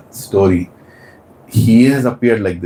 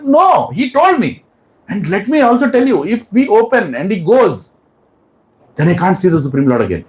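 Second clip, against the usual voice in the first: refusing king; 9 semitones higher than usual. Acted. King argues back.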